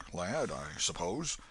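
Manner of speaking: ordinary delivery, nothing marked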